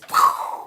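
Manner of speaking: loud puffed gunshot noise; mouth-made bang; imitated gunshot. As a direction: blow out a sudden "pkhh" for a gunshot. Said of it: "suddenly this".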